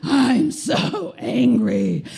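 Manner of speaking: slow, drawn-out monster anger; inner Hulk. Retold or imitated. Client venting rage on the futon, slow mock Hulk. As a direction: stretch each word into a mock beastly growl. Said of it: "the inner Hulk is like".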